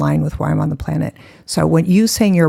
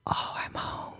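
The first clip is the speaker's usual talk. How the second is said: breathy whisper of relief; hushed, voiceless exhale of wonder; awed sigh; 16 dB quieter, much slower than usual. Retold or imitated. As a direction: whisper it like you've finally arrived. Retold awed relief at arriving.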